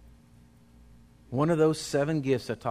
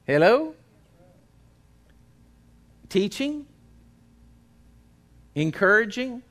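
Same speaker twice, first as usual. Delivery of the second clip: ordinary delivery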